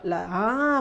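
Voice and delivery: normal talking voice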